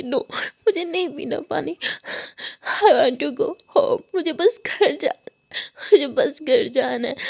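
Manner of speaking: put-on sobbing, childlike plea; crying refusal. Student begging to go home, crying. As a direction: refuse through sobs, breath catching.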